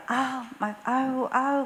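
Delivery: mock irritated groans. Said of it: "짜증이 났는지".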